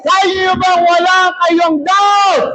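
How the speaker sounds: high, stretched challenge to the crowd; rallying shout. Real, not acted. Preacher challenging the congregation to believe, high shout. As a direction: call the question out high to rouse them.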